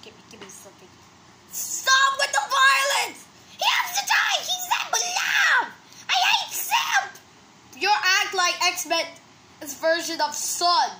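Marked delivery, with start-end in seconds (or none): 1.5-7.1 very high-pitched, hammed-up furious yelling; quarrelling shout. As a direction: shriek in outraged protest. Acted quarrel between characters.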